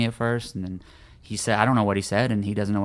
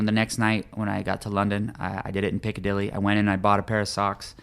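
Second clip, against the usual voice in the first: usual speaking voice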